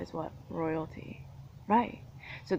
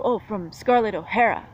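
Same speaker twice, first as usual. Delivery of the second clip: imitating accusers; 3 semitones higher, 9 dB louder than usual. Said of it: "And then you go".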